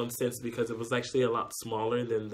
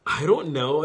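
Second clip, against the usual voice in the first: mock bewilderment; 4 semitones higher, 10 dB louder than usual. Retells friend's puzzled answer on noodles.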